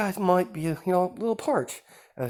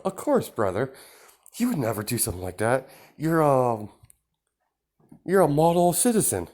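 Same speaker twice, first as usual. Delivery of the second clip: sarcastic; 5 semitones higher, 4 dB louder than usual. Acted. Sister sarcastically agrees.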